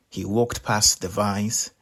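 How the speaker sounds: ordinary delivery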